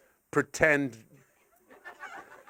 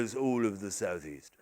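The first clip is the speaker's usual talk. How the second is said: low, understated landowner; English landowner's voice; 5 semitones lower, 5 dB quieter than usual. Acted. Landowner saying how big the farm is, low English voice. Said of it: "developed a landowner's voice".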